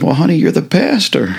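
high, sweet mock wife voice; wife reminding husband he's the pastor; wife's punchline. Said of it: "She says".

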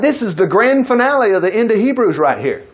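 usual speaking voice

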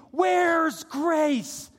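loud, high pulpit cry; imitated scream for help; screaming plea. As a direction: cry it out like a desperate demand. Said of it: "everything they were doing was screaming".

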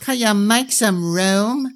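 put-on high, slow haughty little boy; arrogant. A narrator's reading. Little one to Brian, high slow arrogant child. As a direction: demand it imperiously. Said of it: "arrogant look".

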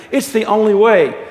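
acted angelic insistence; insistent angel. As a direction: declare it firmly, high and loud. Angel insists on killing lizard.

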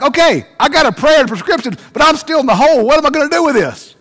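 high, loud exasperated complaint. Acted. Stuck man complaining about the prayer, exasperated high. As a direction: throw the question back in disbelief.

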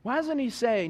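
normal talking voice

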